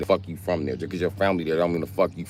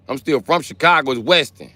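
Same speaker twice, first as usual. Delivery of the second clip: heated insist; 6 semitones higher, 3 dB louder than usual. Real, not acted. Arguing with chat over the street name.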